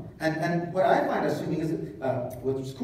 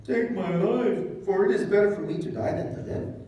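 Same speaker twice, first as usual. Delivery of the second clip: whiny Jonah; 6 semitones higher, 4 dB louder than usual. Acted. Preacher acts out Jonah's death wish.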